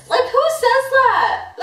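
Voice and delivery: high, incredulous laughing squeal. Real, not acted. Recalling the ex's "like a sister" line, incredulous laugh. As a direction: laugh it off in disbelief.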